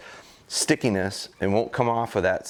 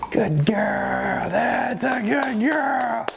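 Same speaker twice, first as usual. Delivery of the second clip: high, slow whispered praise for the pup; tender praise; 13 semitones higher, much slower than usual. Real, not acted.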